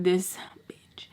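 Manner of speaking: soft, breath-only whisper; whispered curse. Retold or imitated. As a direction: whisper it under your breath. Muttered curse at absent friend.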